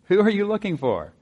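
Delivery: Jesus playfully asking Mary, smiling. Retold or imitated. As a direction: tease her gently, eyes twinkling. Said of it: "there's gotta be a twinkle in the eye, a smile on the face".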